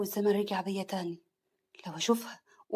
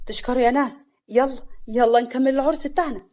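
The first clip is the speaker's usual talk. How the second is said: loud, raised celebratory call; rallying the crowd; 2 semitones higher, 6 dB louder than usual. Acted. Groom calling wedding guests to carry on, loud and lively. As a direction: call the guests back to the party.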